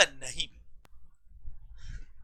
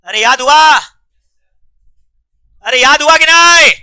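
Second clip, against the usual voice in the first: loud, raised-voice teacher's check; loud demand, pitched up at the end; insistent; 3 semitones higher, 8 dB louder than usual. Real, not acted. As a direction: press the class hard for an answer. Teacher loudly pressing class for answer.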